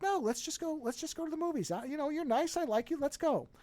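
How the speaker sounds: high, earnest innocent voice; virtuous innocent. Retold or imitated. Man assuring her it's only the movies, high innocent voice. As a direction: insist innocently, voice lifted throughout. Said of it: "angling him as a virtuous innocent".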